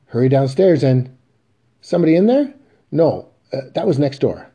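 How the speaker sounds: nervous loud excuse; flustered cover-up. Acted. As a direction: Willie scrambling to hide the woman. Willie caught, covering up.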